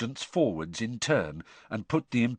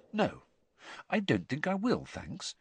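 polite, higher-pitched refusal; a little firmer; 7 semitones higher, 5 dB quieter than usual. A narrator's reading. Inner voice turning down the curse, mildly firm. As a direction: decline lightly but firmly, dropping off on thanks. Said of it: "said the other voice, a little more firmly".

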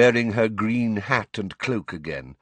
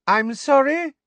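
very high, loud pointed query; high-pitched raised-voice official; 7 dB louder than usual. A narrator's reading. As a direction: pretend not to hear, piercingly polite. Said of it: "said Professor Umbridge loudly".

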